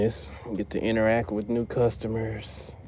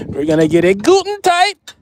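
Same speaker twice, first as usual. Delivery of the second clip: loud, high hammed-up German accent; joking German accent, much higher and louder; mock German accent; 9 semitones higher, 10 dB louder than usual. Shown as a demonstration. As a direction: bark it like a cartoon German mechanic. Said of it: "with a German accent".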